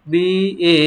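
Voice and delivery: usual speaking voice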